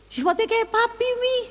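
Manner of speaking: very high, protesting wail to mum; keluhan anak. Retold or imitated. Anak mengadu kepada ibunya. (mengadu dengan nada melengking tinggi)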